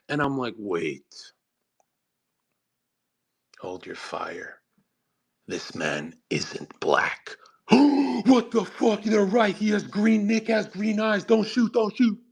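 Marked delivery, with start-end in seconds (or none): 0.6-7.4 much lower, softer and slow, steadying a crowd; calm command. Retold or imitated. Calming the Albanian crowd. 7.5-12.2 mock panic. Albanians panicking after the reveal.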